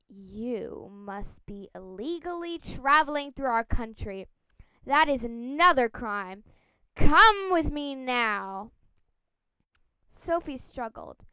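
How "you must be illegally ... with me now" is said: loud, slow stern arrest order; london policeman. Acted. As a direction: order her off like a strict bobby.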